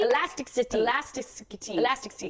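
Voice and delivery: stumbling over "elasticity", syllables tangled; repeated garbled tries at "elasticity"; tongue-twister struggle. Shown as a demonstration. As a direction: try to get "Elasticity" out and trip on it. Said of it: "You gotta be tongue twisting over here".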